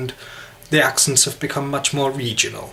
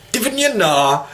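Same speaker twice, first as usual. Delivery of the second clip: high-pitched mock Newcastle accent; attempted Geordie; 8 semitones higher, slower than usual. Shown as a demonstration. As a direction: toss it out in broad Geordie.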